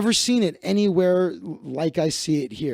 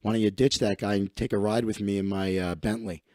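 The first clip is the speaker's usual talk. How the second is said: lower, softer smug pickup line; oily rich creep trying to steal a girlfriend; smug rich guy; 9 semitones lower, 7 dB quieter, faster than usual. Retold or imitated. Rich LA guy hitting on a girlfriend, lower and softer smug. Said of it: "walk up to a girl with her boyfriend and be like".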